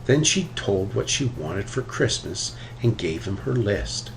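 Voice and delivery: ordinary delivery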